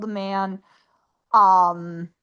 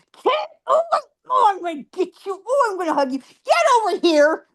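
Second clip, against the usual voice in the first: high, teasing sing-song threat; playful mock threat; 8 semitones higher than usual. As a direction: tease the ghost as if chasing a child. Teasing the prankster ghost.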